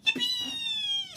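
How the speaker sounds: very high-pitched squeal of joy; excited shout. A narrator's reading. Ruby hears Santa call her, excited high shout. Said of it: "shouted Ruby".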